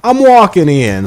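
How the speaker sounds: high, loud mock-Italian outburst; mock furious Italian street shout; angry Italian. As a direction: yell it like a hammed-up angry Italian. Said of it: "If an angry Italian came up to me".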